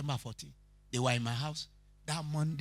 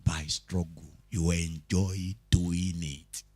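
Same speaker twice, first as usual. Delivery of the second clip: slow reassurance; 7 semitones lower, slower than usual. Real, not acted. Preacher softens over music.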